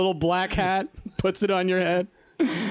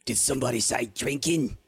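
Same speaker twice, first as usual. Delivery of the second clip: little voice; 6 semitones lower, 4 dB quieter than usual. Acted. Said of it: "you hear a little voice".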